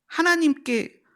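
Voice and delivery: usual speaking voice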